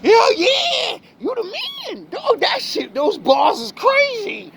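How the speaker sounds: high, loud mocking hype-man voice; shouted fake praise from hangers-on; hype flatterers. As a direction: ham up the fawning crowd, way up high. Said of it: "and they".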